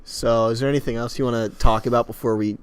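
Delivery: ordinary delivery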